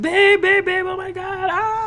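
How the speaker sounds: high loud frantic calling; frantic yelling. Retold or imitated. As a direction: yell for your partner in panic. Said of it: "like a crazy person".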